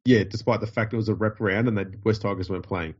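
ordinary delivery

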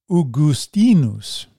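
Augustinus said slowly, stress on the second-to-last syllable, ow-goo-STEE-noos; Latin Augustinus. Shown as a demonstration. Reading a scholar's note aloud, Augustinus said slowly with stress on STEE. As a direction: draw out Augustinus, leaning on STEE. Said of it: "with the accent on the penultimate syllable".

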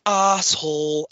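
slow, drawn-out Canadian crowd chant; Canadian accent. Retold or imitated. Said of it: "I can't really do a Canadian accent".

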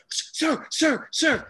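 high, urgent little interruptions; imitating a mortified cashier; flustered hushing. Cashier tries to hush him.

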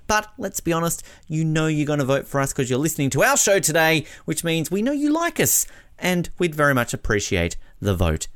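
bright, high, eager ad read; upbeat promo read. Acted. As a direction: sell the vote pitch with energy. Podcast award vote promo read.

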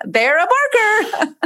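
laughing through the words They're a barker, high, slow; giggling repeat of They're a barker; amused, slowly drawn out with a laugh. Real, not acted.

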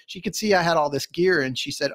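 ordinary talk

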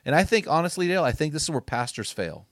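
fired-up preaching. Acted. Podcast host rallying men to finish strong, fired-up preaching.

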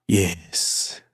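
low, hushed villainous relish; mock gloating. New boss relishing power over old boss, gloating. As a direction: savor it low and soft, mildly villainous.

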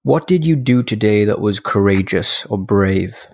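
unhurried guided reflection, leaving space after each phrase; guided meditation. Acted. Podcast host guiding listeners through end-of-day review, calm meditation voice.

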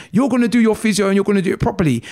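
fast, high, loud telling-off; shouting. Retold or imitated. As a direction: scold fast like a strict physio. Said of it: "She's shouting".

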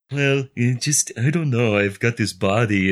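hammed-up shyness about his own body; mock shy. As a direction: play the big barbarian coyly shy. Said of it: "And he's like all shy".

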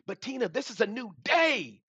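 high, fervent insistence; carried away, pitch soaring on the key words; real impassioned outburst. Real, not acted. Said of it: "So sorry to get like that".

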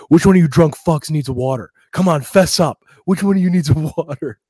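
loud mock server voice calling out; mocking bartender. Bartender loudly calling to the drunk table. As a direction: imitate the bartender hollering at the table. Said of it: "He goes".

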